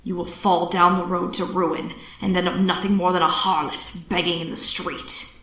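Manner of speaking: low, emphatic warning; stern father. A narrator's reading. Father warns his child of ruin, deep and stern. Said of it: "slapping his belt into his palm for emphasis".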